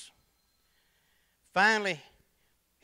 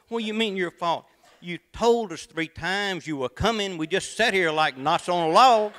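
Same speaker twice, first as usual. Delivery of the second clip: churchgoer retort; 5 semitones higher, 7 dB louder than usual. Acted. Church member consoling, punchline.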